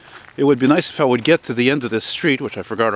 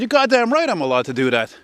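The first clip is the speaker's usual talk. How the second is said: retelling a proud comeback to his brother, raised and defiant; defiant retort; 8 semitones higher than usual. Retold or imitated. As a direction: fire back like nobody can stop you. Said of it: "I said".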